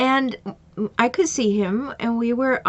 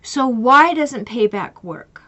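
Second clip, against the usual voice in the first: ordinary talk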